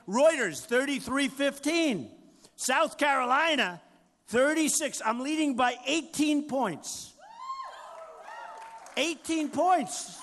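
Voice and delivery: boasting shout. Real, not acted. Boasting poll numbers to crowd.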